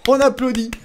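high, elated celebration; excited cheering. Real, not acted. Cheering the prince's change of target.

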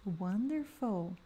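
slow, soft teacher praise. Acted. Teacher praising students after each repeat, soft and slow. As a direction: reassure the learner gently.

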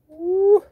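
excited whoop. Real, not acted. Excited about the car.

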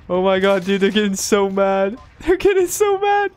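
high, loud giggly disbelief; can barely get the words out for laughing; squealing with laughter at the raging players. Real, not acted.

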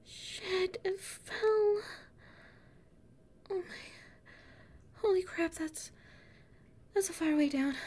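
high startled dismay; shocked dismay. Acted. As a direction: stare down the drop in shock. Watching backpack fall away.

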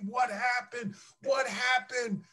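high, anguished retelling of despair; retold anguish. Player recalling draft-day despair, high anguished cry.

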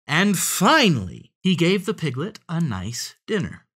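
singsong list climbing in pitch; animated story narration. A narrator's reading. Telling how the fox readies a bath, animated narration.

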